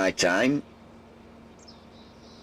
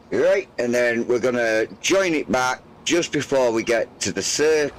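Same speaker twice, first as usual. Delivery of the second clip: ordinary talk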